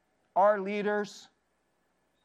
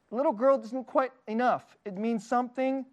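ordinary talk, nothing marked

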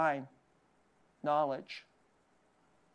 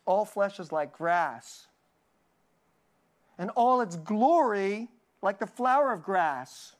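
scripture reading. Acted. Reads scripture slowly.

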